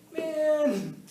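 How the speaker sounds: loud, high, drawn-out groan of protest; frustrated outcry. Acted. Teacher voicing the wrongly blamed, frustrated outcry. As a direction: cry out at how unfair it is.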